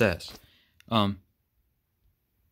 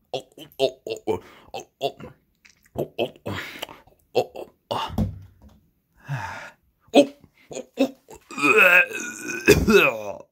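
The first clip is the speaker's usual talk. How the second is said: disgusted groaning. Acted. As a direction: groan like the medicine was awful. Just tasted bad medicine.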